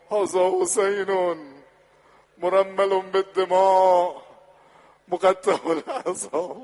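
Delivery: loud, high, slow lamenting chant; mourning recitation. Acted. Preacher lamenting to the Prophet, loud mourning chant. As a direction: cry the verses out to the Prophet.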